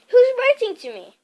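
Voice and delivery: high loud question; demanding. A narrator's reading. Harry presses Uncle Vernon, loud. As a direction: press him for answers.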